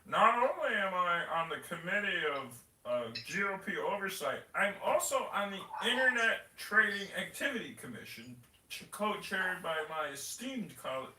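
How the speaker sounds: high, mock self-important politician; hammed-up pompous committee announcement; Darren LaHood. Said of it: "There's a clip of him saying".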